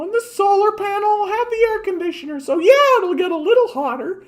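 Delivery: mock the clueless tech optimists in a silly high voice; mocking naive optimist. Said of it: "People think".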